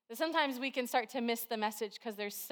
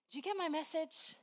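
softer, hushed voice of God; soft whisper; 5 semitones higher, 6 dB quieter than usual. Retold or imitated. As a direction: hush it like a gentle call from heaven. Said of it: "he just kinda whispers at us".

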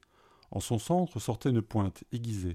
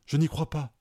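overjoyed; 5 semitones higher than usual. A narrator's reading. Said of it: "Il était fou de joie".

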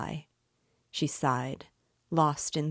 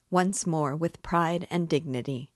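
usual speaking voice

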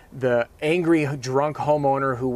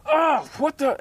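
mock tantrum; 14 semitones higher, slower than usual. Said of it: "I'm about to have a tantrum".

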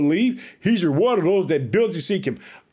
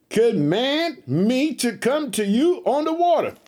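raised, measured preacher's scripture read; 4 semitones higher than usual. Acted. Preacher reading the Gospel aloud to his church, raised and measured. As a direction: lift the Bible verse up to the congregation.

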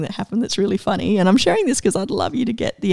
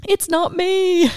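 slow, high relief; 8 semitones higher, slower than usual. Retold or imitated. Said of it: "this excitement of".

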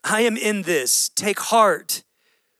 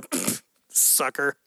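slow, softer, scornful put-on voice; mocking scoff; 5 semitones lower, 6 dB quieter, slower than usual. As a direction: scoff at the sinking man. God mocked as scornful bystander.

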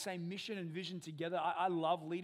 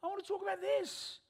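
high, louder whining complaint; whiny believer; 14 semitones higher, 6 dB louder than usual. Retold or imitated. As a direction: whine like a stubborn kid to God. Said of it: "where we're like".

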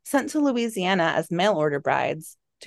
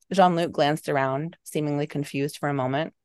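ordinary delivery

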